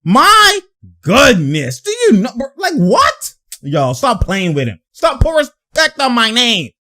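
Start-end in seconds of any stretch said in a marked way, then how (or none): 0.0-1.8 high, hyped my goodness; hyped my goodness at a big shot; excited exclaim. Real, not acted. Hyped reaction. 1.8-3.3 high, incredulous squeal; stunned by the play, high incredulous squeal; stunned disbelief. Real, not acted.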